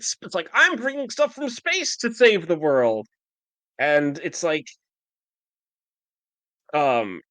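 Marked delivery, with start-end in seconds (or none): 0.5-3.1 high-pitched mock grandstanding; showy mock boast. Host mocks the obsession with space, showy boasting. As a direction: brag in a raised, mocking pitch.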